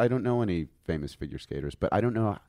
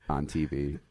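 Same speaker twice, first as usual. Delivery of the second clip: ordinary talk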